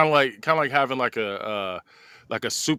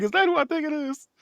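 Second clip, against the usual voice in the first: high-pitched mock amazement; incredulous high squeal of a question; astonished onlooker; 13 semitones higher than usual. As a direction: play a stunned bystander, voice shooting up. Office worker spotting Magneto outside.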